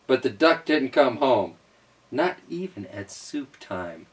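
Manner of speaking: usual speaking voice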